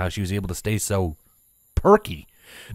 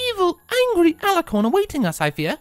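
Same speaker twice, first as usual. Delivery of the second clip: high refined mare voice. A narrator's reading. Rarity explains the cloaks.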